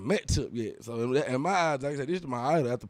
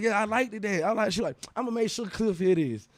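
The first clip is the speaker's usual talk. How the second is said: higher woman's voice; retold Tiny in a higher voice; voicing Tiny; 8 semitones higher than usual. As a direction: mimic Tiny, pitched up.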